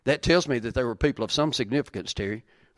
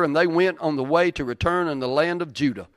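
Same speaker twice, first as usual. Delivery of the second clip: normal talking voice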